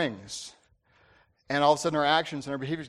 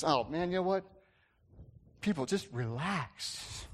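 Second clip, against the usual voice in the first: relaxed; 5 dB quieter, slower than usual. Acted. Quarterback answering radio hosts about panicking fans, relaxed. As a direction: imitate an unbothered, softened quarterback. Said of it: "he said it in such a relaxed way".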